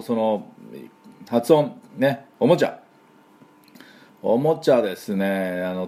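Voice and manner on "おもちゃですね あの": ordinary talk, nothing marked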